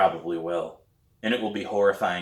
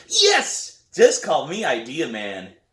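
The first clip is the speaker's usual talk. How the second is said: put-on high, loud kid's cheer; excited kid brother; 5 semitones higher, 5 dB louder, slower than usual. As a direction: cheer it out like a thrilled little boy. Brian cheers.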